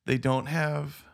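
put-on high, childlike little kid voice; six-year-old voice. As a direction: talk like a small child. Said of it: "in a six-year-old voice".

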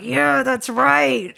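slow, high, loud gloating "that's right"; smug retort. Retold or imitated. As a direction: drawl it out, pleased with yourself. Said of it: "I was like".

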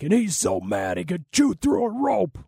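high, put-on radio announcer voice imitating the broadcaster; Brian Wheeler impersonation. Podcaster imitating a radio play-by-play man, high put-on announcer voice. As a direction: ham up a colorful play-by-play line. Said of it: "Wheels is like".

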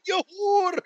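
high, slow mock Scottish brogue, hammed up; hammed-up Scottish accent; put-on Scottish voice. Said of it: "in the Scottish language".

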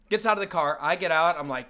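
usual speaking voice

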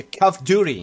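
slow "Duty" with a tapped r, "diuri". Shown as a demonstration. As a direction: model "Duty" as "DIU-ri", stretched out. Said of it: "põe um R, pra facilitar".

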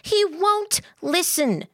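high, slow, insistent protest; raising her voice. A narrator's reading. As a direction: drive each word home at high pitch. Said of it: "raising her voice further".

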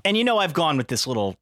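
ordinary delivery

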